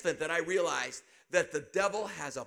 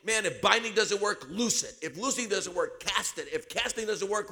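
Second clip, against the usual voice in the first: retold advice building to a yell; building to shout; 2 semitones higher, 4 dB louder than usual. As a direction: preach it harder and harder until you yell. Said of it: "they were telling me".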